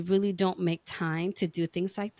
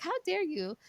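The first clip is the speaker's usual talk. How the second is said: high, softer hammed-up "how dare you"; mock outrage; 10 semitones higher, 4 dB quieter than usual. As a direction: play offended for laughs.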